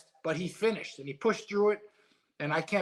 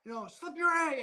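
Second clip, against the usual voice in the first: put-on much higher child's voice calling from the corner; imitating his young son; 12 semitones higher than usual. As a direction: pitch way up like a ten-year-old kid yelling advice. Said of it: "you can hear his voice, his loud voice".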